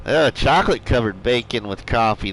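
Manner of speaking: ordinary talk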